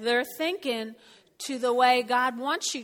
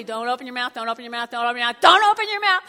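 escalating warning, louder and faster; repeating the warning, rising to a loud plea; Spirit's warning building to a yell; inner voice warning; 10 dB louder, faster than usual. Retold or imitated. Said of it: "'cause he's telling me".

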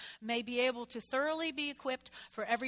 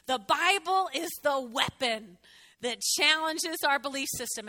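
preaching emphasis; 2 semitones higher, 6 dB louder than usual. Real, not acted. Preaching key point.

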